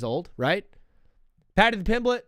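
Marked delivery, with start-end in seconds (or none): 1.5-2.2 "Paddy the Pimblett" said higher and louder, mock boomer style; hammed-up "Paddy the Pimblett" with the extra "the"; boomer loser way. Shown as a demonstration. Host mocking his own boomer way of naming the fighter. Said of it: "I always say Paddy the Pimblett like an absolute boomer loser".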